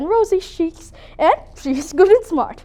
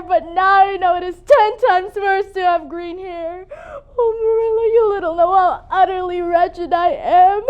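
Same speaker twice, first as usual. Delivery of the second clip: crying through the words; tearful despair. Acted. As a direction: sob out her misery to Marilla. Despair over green hair.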